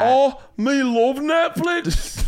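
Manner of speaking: high, slow, mangled Scottish woman; badly faked Scottish accent, drawn out and high; bad female Scottish accent. Host playing a Scottish chick, bad Scottish accent. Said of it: "I'll do female Scottish accent".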